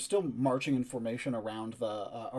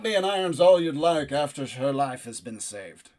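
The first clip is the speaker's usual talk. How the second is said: defiant knight; 3 semitones higher, 9 dB louder than usual. Acted. Knight defiantly addressing the keep.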